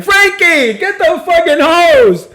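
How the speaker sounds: put-on high, loud Italian accent shout. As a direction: bark it like an Italian nurse. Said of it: "such a good Italian accent".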